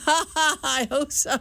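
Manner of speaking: slow words through real laughter; amused reply broken by laughs; laughing. Real, not acted. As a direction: laugh while you answer. Interviewer laughs at wife joke.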